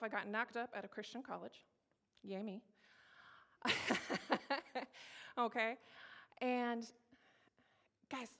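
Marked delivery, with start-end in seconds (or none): 3.6-5.3 breathy burst of laughter; real laugh at your own misfortune. Real, not acted. Laughs at own sarcastic yay me.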